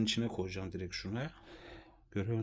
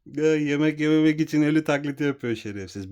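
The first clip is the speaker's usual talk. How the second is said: loud, high amused indignation; exasperated outburst; 5 semitones higher, 10 dB louder than usual. Real, not acted. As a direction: burst out, half laughing, half outraged. Exasperated laughing outburst at pet.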